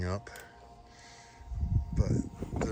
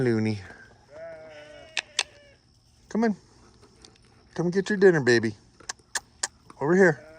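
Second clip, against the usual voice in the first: calling a dog; 4 semitones higher, much faster than usual. Real, not acted.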